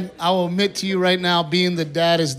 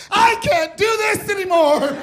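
high, slow exasperated shriek; high, slow hammed-up meltdown wail; wife's breakdown shout; slower than usual. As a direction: shriek it like she's at the end of her rope. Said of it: "my wife just goes".

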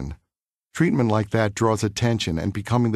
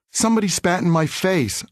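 put-on tearful child's complaint, pitched up; mild crying, voice lifted high; a boy weeping to his mom, understated; 8 semitones higher than usual. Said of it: "I wept".

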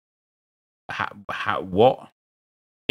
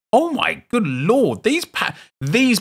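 amazed; 12 semitones higher, slower than usual. Real, not acted. Amazed at pack.

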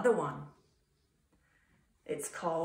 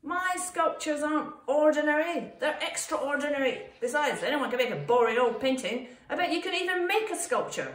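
indignant, higher-pitched snap; snapped retort; 7 semitones higher, 6 dB louder than usual. A narrator's reading. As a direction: snap back, pitched up and emphatic. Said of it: "snapped Roy".

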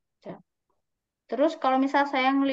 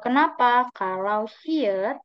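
ordinary talk

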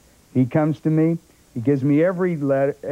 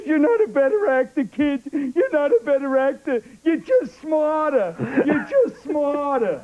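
put-on old director's verdict; 13 semitones higher than usual. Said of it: "And he said".

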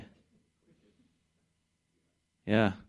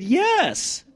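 squeaky, much higher "Yes" mimicking her; mock lady voice. Preacher mimics the charismatic lady agreeing, squeaky and much higher. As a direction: ham up the lady's eager yes.

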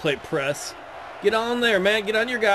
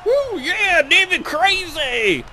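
whooping, high and loud, then slowed; excited cheer; 13 semitones higher, 4 dB louder, slower than usual. Real, not acted. Gamer whooping to fire up his team, excited. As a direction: hype the team like a cheering fan.

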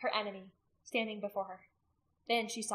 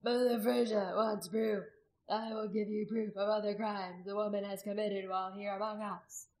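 lightly oratorical, measured public address; orator's voice. A narrator's reading. Accuser promising proof to the crowd, mildly oratorical. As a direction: announce it like a practised orator. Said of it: "he said in his orator's voice".

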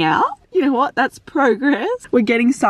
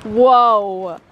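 usual speaking voice

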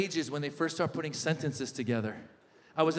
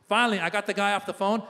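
ordinary delivery

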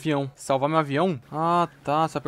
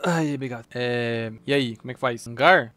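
normal talking voice

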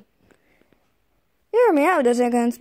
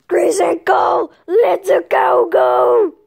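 shouting; 4 semitones higher, 4 dB louder than usual. Real, not acted. Calling out to friends.

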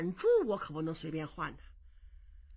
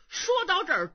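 usual speaking voice

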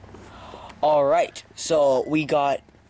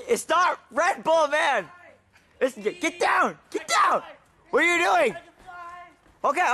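high calling shout; 10 semitones higher than usual. Acted. Yelling up at Frank to get down, high calling shout. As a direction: yell high up at a friend who climbed too high.

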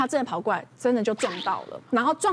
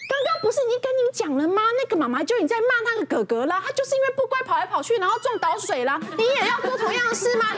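fast, high pointed scolding; scolding her son; 10 semitones higher than usual. Retold or imitated. Mother at next table scolding her son as an example, fast and high. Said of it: "就立刻就跟他儿子说".

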